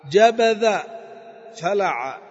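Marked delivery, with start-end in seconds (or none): 0.1-0.8 جبذ said much louder and a bit higher; teacher's loud example; emphatic demo word. Shown as a demonstration. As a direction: punch جبذ loud to show the swapped letters. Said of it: "تقلب".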